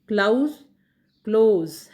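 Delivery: क्लाउज, क्लोज loud with a gliding "ou" vowel; O-U glide. Shown as a demonstration. Teacher models the first word, loud gliding क्लोज. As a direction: project the glide in क्लोज, ending on z. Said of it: "ये औ sound है".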